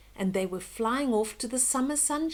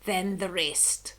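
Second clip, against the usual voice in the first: haughty laugh; 3 semitones lower, 7 dB louder than usual. Acted.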